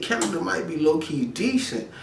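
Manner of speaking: talking himself into Canada, pitched high; high musing. Retold or imitated. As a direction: float it up high like a daydream. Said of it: "I'm like".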